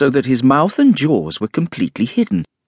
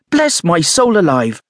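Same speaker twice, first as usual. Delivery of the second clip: higher-pitched landlady's outburst; sudden exclamation; 8 semitones higher, 4 dB louder than usual. A narrator's reading. Landlady suddenly remembering the kitchen work, exclaiming. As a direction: burst out as if just remembering. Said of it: "going off at a tangent".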